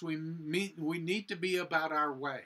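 ordinary delivery

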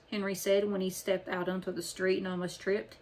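usual speaking voice

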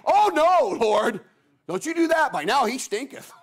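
high, pleading objection from Martha; protesting Martha. Retold or imitated. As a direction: cry out in high-pitched alarm, don't open the tomb.

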